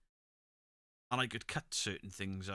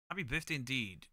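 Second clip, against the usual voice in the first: usual speaking voice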